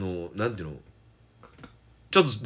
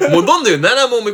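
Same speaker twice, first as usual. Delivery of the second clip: loud, high, excited announcement; high-energy quiz host call; excited quizmaster; 13 semitones higher, 9 dB louder, faster than usual. Real, not acted. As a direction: push on to the next question, fired up. Excited quizmaster pressing on to next question.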